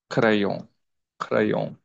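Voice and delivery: క్రయాన్ with a nasal end and no N; French crayon. Shown as a demonstration. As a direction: say క్రయాన్ without sounding the N. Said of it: "N is not spelled here". (క్రయాన్ N పలకకుండా)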